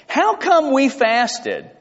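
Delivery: high, slow, drawn-out whine; mock an aggrieved teenager, dragging the words out; complaining teenager. Preacher quotes the people asking God why they fasted, teenage complaint. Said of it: "That sounds like a teenager".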